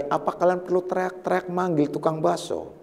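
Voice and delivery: usual speaking voice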